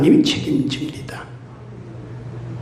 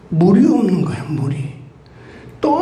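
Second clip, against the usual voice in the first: normal talking voice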